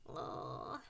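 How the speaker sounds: low, breathless groan of disgust. A narrator's reading. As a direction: recoil with a voiceless ugh. Disgusted at maggots in wound.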